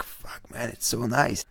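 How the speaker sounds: hushed awed whisper. Retold or imitated. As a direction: breathe it out in awe. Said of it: "you're like".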